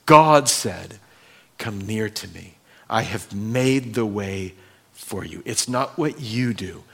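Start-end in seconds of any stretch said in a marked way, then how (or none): 1.6-5.4 soft tender invitation. Acted. God's invitation again.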